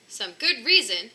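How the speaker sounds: wife scoffing at the intruder; cried out. Acted. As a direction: scoff at his excuse loudly. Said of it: "she cried".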